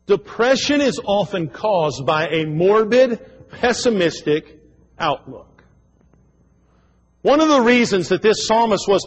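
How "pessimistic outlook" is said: ordinary talk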